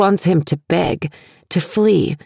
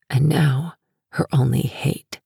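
usual speaking voice

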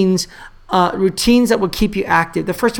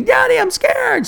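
high, drawn-out imitation of a frightened kid; scared child's yell. Young son calling to dad watching a show, high mock scared yell. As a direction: wail it like a little boy, hammed up. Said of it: "Did it again".

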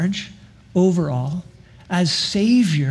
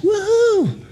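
put-on much higher, eager childlike devotion; joyful childlike prayer; 8 semitones higher than usual. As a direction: pray like a gleeful kid on a bike. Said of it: "may we be just".

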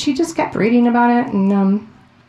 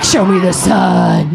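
lower, slow, faint plea; feeble dying voice; 4 semitones lower, 3 dB louder, slower than usual. Pretend. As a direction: croak it like a barely alive twin. A weakened breast voiced pleading.

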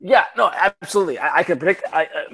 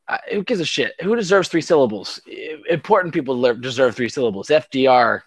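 normal talking voice

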